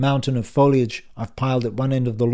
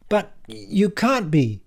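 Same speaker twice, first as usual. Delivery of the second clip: higher, slow, drawn-out denial; slow disbelief; 10 semitones higher, much slower than usual. Acted. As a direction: plead it can't be true. Dan disbelieving.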